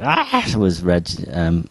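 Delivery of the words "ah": high startled yelp; mock yelp. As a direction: let out a sharp high yelp.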